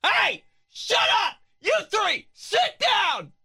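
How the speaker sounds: put-on high yelling mom scolding the kids. Mom shouting at her three kids, yelling. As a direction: bark at the three kids to sit down. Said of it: "That's my mom's whole entire life".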